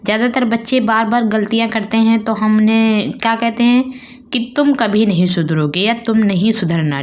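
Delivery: ordinary talk, nothing marked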